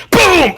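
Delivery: high-pitched falling "boom"; imitated impact. Storyteller imitates chair hitting head, imitated impact. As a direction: voice another blow of the chair.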